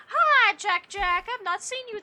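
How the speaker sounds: high, loud, slow cooing greeting; sing-song pet greeting. Real, not acted. Greeting her cat in pet voice.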